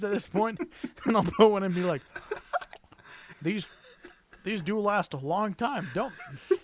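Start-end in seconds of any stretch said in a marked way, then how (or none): 3.4-6.6 British grandpa with dentures. Acted. Grandpa stuck chewing caramel, British denture voice. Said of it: "like, horrible teeth, maybe dentures".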